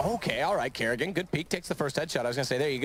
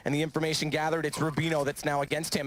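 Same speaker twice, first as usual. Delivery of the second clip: rising excited play-by-play, higher; esports caster; 5 semitones higher than usual. Real, not acted. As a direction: call the clutch as it happens. Live play-by-play of a clutch.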